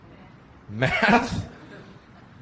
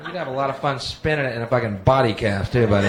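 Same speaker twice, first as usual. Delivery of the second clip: normal talking voice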